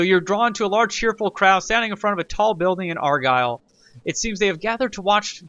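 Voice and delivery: narrating the event text aloud; game event narration; reading game text. Acted. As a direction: read it like a storybook.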